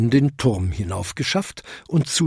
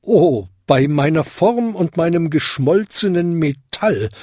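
higher-pitched shocked exclamation; a touch of astonishment, pitched up; hollow-ringing bell; 6 semitones higher than usual. A narrator's reading. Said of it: "Ding läutete hohl".

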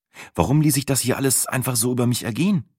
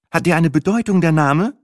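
higher, louder question; curious Sina; 9 semitones higher, 5 dB louder than usual. A narrator's reading. Sina asks about the name, curious. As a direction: ask with interest. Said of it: "wollte Sina wissen".

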